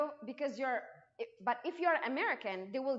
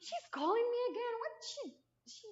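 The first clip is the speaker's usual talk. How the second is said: exasperated; 10 semitones higher than usual. Retold or imitated. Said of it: "I was like".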